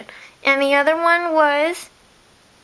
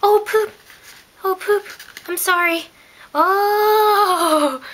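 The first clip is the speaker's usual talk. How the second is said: real little yelp of dismay; startled dismay; 6 semitones higher, slower than usual. Real, not acted. Spilled something.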